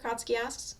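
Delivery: ordinary delivery, nothing marked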